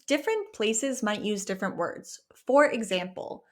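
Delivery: ordinary talk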